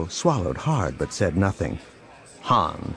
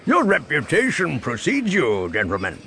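resonant unseen crime boss; disembodied baritone voice; 7 semitones higher than usual. A narrator's reading. Unseen boss offering guests drinks, resonant baritone. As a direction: suggest it as a resonant, hidden host. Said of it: "source of the resonant voice".

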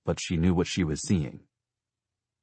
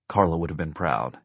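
plain reading voice

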